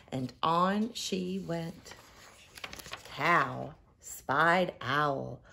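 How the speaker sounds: ordinary delivery, nothing marked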